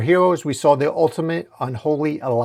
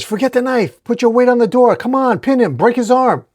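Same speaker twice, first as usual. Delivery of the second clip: high, loud, frantic commands; raised, high-pitched urgency; urgent shouted orders; 10 semitones higher, 6 dB louder than usual. A narrator's reading. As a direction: shout orders mid-fight. Urgent orders during a fight.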